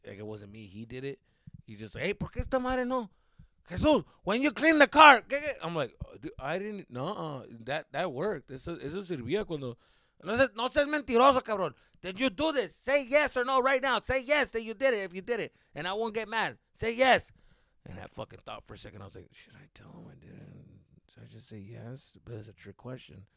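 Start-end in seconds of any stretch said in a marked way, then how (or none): 2.0-17.3 loud, higher demanding father; stern dad. Retold or imitated. Dad interrogating in story. 19.3-22.1 whispered thought. Retold or imitated. Inner thought hushed.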